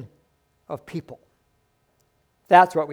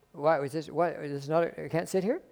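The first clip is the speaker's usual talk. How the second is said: soft, puzzled visitor voice, quieter; meek visitor; 10 dB quieter than usual. Retold or imitated. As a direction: ask it softly, taken aback. Visitor protests to the usher.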